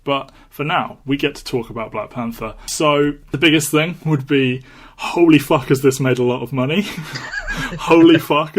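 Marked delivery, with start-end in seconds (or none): 2.7-6.9 excited. Real, not acted. Gushing about the box office. 6.9-8.6 laughing. Real, not acted. Laughing amazement at the film.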